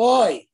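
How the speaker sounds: groaning oy. Retold or imitated. Israelites in Egypt just groan, loud mock oy. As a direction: sigh out a heavy oy. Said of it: "they go".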